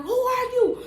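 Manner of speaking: shrill high yelling while banging; high mock yell. Friend banging on the car door, high loud yell. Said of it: "She banging like".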